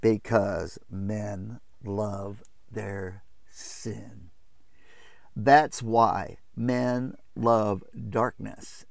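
very slow, weighted delivery; grave, deliberate. Real, not acted. Preacher answering why they reject truth, slow and deliberate. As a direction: spell it out word by word, slowly. Drives home the closing point.

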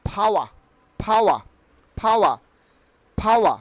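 "ফাওয়া" as phaa-wa, silent r; corrected pronunciation. Shown as a demonstration. Teacher drilling learners, short "ফাওয়া" with no r. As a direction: puff the p in "ফাওয়া", drop the r.

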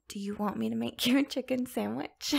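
ordinary talk, nothing marked

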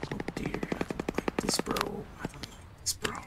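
hushed scared whisper. Real, not acted. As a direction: breathe it out so nothing hears. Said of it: "I'm gonna have to be quiet".

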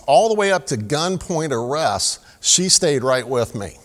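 normal talking voice

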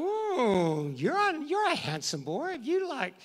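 high, gushing flirty voice, swooping up and down.

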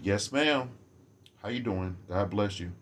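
soft, low, courteous greeting; gentle, mannerly well-wishing, voice dropped; very polite. Acted. Greeting people while holding the door, softly polite. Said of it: "polite as I could be".

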